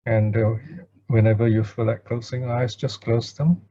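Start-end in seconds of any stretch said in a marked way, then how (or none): none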